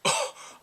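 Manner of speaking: loud, high voiced gasp; shocked gasp. Viewer shocked by the cheeky student, sharp high gasp. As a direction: gasp in mock scandal.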